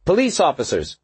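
high, firm announcement. A narrator's reading. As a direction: declare it with authority. Cop announces herself to gang.

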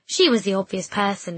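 ordinary delivery

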